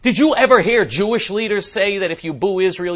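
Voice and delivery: ordinary delivery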